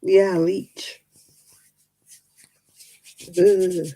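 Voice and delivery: ordinary talk, nothing marked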